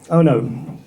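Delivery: normal talking voice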